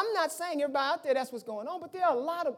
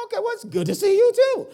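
high, louder mock-cheerful reply; puzzled returned greeting; 10 semitones higher, 6 dB louder than usual. Bemused husband answering cheerful wife, mock cheerful. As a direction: echo the greeting back, pitched way up. Echoing wife's greeting back.

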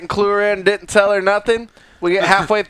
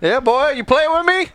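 playful imitation, rising higher at the end; mock old-man challenge; 5 semitones higher than usual. Friend echoes the old guy from the story, mock challenge. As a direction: tease with a put-on challenge, ending high.

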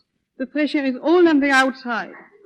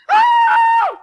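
high, loud shriek; scream; 8 dB louder than usual. Acted. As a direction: shriek as loud as you can. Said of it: "I'll scream".

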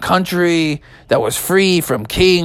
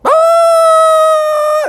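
very high loud scream; high panicked shriek; falling scream; 5 dB louder than usual. Acted. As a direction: scream as they drop. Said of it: "they all went".

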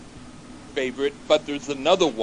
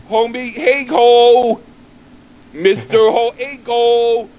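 much higher, loud, slow calling out; garbled dinner call; 7 semitones higher, 8 dB louder, slower than usual. Retold or imitated. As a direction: holler it across the house. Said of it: "But they said".

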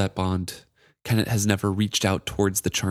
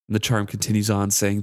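ordinary talk, nothing marked

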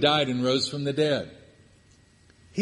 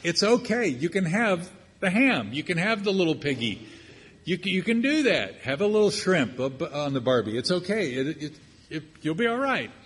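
higher, wheedling mock voice coaxing Pete; 5 semitones higher than usual. Preacher mocks someone coaxing Peter to eat ham. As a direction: sweet-talk him like a pushy friend. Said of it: "you might say".

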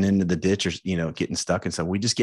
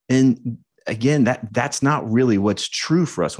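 ordinary talk

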